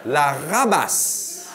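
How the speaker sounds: ordinary talk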